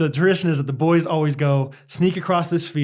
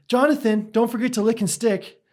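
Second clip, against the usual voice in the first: a lightly voiced sweet kid, higher; mild sing-song reminder from a sweet child; sweet camper; 7 semitones higher than usual. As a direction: chirp it like an eager little camper. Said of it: "this sweet camper".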